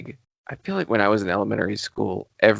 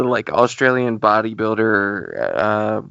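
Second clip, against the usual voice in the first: ordinary delivery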